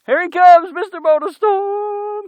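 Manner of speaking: loud, high, drawn-out announcer call; hype announcement. Real, not acted. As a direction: announce him like a stunt star. Announcing a rider jumping.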